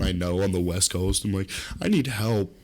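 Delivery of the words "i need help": hammed-up helpless pleading; falter, then wail higher and higher; mock stoned panic. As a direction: voice climbing into a high squeal. Acting out stoned panic before security.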